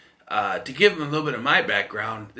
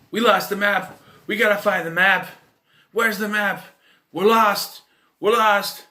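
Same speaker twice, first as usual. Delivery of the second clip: high, loud mock-frantic whining; mock panic; 9 semitones higher, 6 dB louder than usual. Imitating lost hikers hunting the map, mock panic. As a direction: fret frantically, hammed-up.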